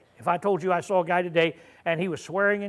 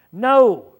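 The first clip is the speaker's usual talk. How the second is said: a single loud, higher no; loud flat denial; 4 semitones higher, 10 dB louder than usual. Retold or imitated. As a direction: slam the door on the question. Peter's final denial acted out.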